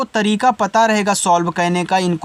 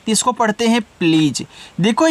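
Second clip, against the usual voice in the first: usual speaking voice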